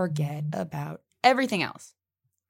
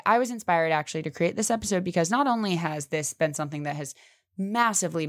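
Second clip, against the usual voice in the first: ordinary delivery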